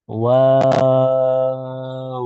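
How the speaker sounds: ওয়াও held long and very slow; stretched waw. Shown as a demonstration. Teacher showing kids how long to hold ওয়াও. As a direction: draw ওয়াও out for a full count. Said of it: "same ওইটা একটু টেনে বলতে হবে".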